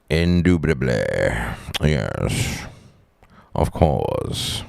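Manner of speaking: put-on Southern drawl sinking low; Southern accent. Fooling around with the accent dude, mock Southern drawl. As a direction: play a hammed-up Southern character. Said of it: "what Southern or how you, um, classify it as".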